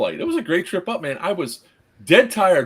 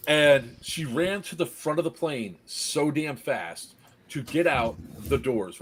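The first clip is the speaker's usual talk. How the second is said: normal talking voice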